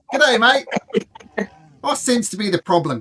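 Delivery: big, high-pitched Aussie zookeeper; terrible Australian accent. Acted. Zookeeper asks visitor the problem, broad fake Australian accent. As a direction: ham up a loud, bad Australian accent. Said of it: "My terrible Australian accent".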